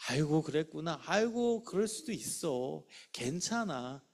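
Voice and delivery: slow, soft and reassuring; accepting. Shown as a demonstration. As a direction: soothe a troubled child gently. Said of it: "막 힘든 얘기야". (아이를 달래듯 천천히 부드럽게)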